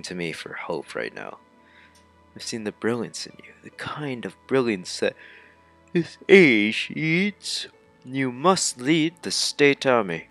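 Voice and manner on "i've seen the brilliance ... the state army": earnest urging, rising to a loud drawn-out peak; lightly voiced game character. Character urging Juju to lead the state army, hammed-up plea. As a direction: build into a mildly hammed-up rallying plea.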